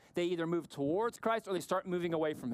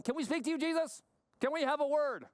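higher-pitched imitation of pestering relatives; family calling from outside; 7 semitones higher than usual. Jesus's family outside asking for a word, high-pitched imploring imitation. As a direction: call in to him, voice raised in pitch. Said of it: "his family is outside going".

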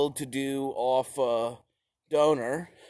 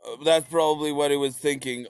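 ordinary talk, nothing marked

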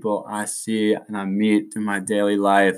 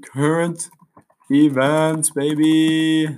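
hype announcer; 6 semitones higher, much slower than usual. Acted. Hyping current events segment.

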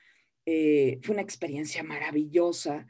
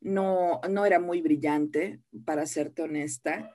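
ordinary talk

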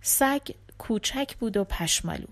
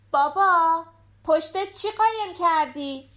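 put-on high, eager child's voice; little girl voice; 8 semitones higher, 3 dB louder than usual. A narrator's reading. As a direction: play the small daughter at the door. Daughter voiced.

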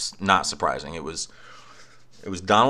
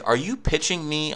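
much higher, mock-puzzled question; incredulous client; 9 semitones higher than usual. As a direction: ask it like a baffled caller who can't believe it. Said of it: "and he's like".

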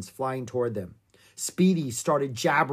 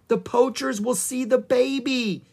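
high, loud cry of alarm; panicked warning; 11 semitones higher, 5 dB louder than usual. A narrator's reading. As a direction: exclaim it in rising panic. Annie panics for the calf.

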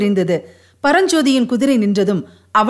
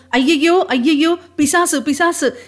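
higher-pitched cry of mild panic; frightened wailing; 5 semitones higher, slower than usual. A narrator's reading. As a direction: wail as if you've seen a demon, without shouting. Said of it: "என்று அலறிக்கொண்டு".

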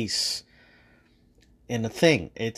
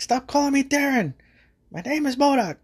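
MODOK voice; 13 semitones higher than usual. Retold or imitated. MODOK correcting Ant-Man, high and indignant. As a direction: squeal it out much higher, offended. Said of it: "and he's like".

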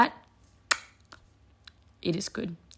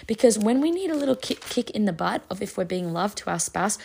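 ordinary talk

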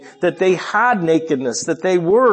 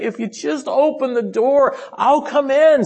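high, eager plea; wanting badly; 12 semitones higher, 4 dB louder than usual. Christ outside asking the church to open, pleading. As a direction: plead to be let in, pitched high. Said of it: "he wants so badly to come back".

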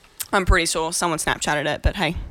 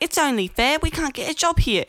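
lightly put-on British accent, higher; a hint of mimicked British whine; mock pommie accent; 8 semitones higher than usual. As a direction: imitate a British backpacker, pitched up. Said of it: "all these other pommies".

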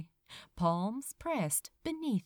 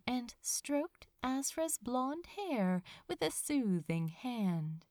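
usual speaking voice

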